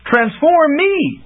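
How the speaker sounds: slow, high, urgent call; inspired cry. Acted. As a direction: seize the idea and shout it. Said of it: "Bink cried with sudden inspiration".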